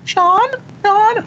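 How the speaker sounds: put-on very high-pitched, childlike name-calling; eerie high voice of a young boy or girl; young child's voice. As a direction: call out the name like an unseen little kid. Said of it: "it sounded like a, a young k-, boy or, like, a girl".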